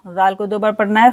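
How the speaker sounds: normal talking voice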